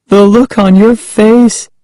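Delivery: put-on high, teasing girl's voice; rubbing it in. A narrator's reading. Prankster still chuckling at her cousin, high taunt rubbing it in. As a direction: repeat it high to needle the victim. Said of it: "rubbing it in".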